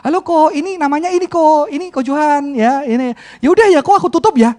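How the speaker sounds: quick bright hello and goodbye on the phone; mimicking the church sister hanging up fast; imitating cheerful caller. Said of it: "W A call ya".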